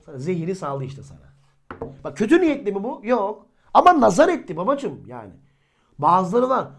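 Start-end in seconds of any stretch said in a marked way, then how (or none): 2.0-6.7 preaching voice. Acted. Preacher raises voice to congregation.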